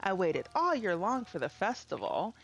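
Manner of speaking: a hint of an outraged merchant fretting over losses; upset villager. Reads an upset villager's lines.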